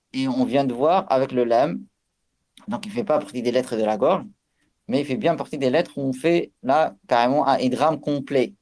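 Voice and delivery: ordinary talk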